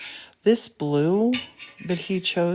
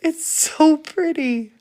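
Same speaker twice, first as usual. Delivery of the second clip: delighted gush; 10 semitones higher, 6 dB louder, slower than usual. Real, not acted. Admiring the finished star.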